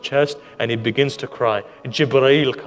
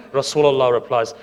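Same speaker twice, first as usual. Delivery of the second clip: ordinary talk, nothing marked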